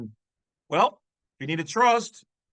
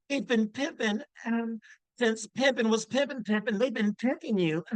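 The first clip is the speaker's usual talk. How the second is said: higher, put-on pimp voice; 4 semitones higher, 3 dB quieter than usual. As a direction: slip into a playful pimp character voice. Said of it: "my pimp voice".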